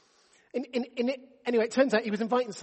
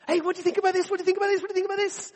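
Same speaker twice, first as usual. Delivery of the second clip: much higher, bubbly and over-eager; eager chatter; 9 semitones higher, 3 dB louder, faster than usual. Retold or imitated. Husband chattering at sleepy wife over tea, hammed-up eager. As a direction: pester her with enthusiasm first thing.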